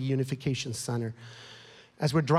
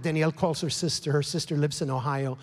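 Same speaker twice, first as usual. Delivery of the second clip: ordinary talk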